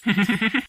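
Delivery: high gloating laugh; villainous chuckle. Acted. Trickster alone after fooling his victim, villainous chuckle. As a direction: chuckle over a trick that worked.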